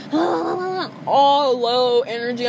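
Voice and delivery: ordinary delivery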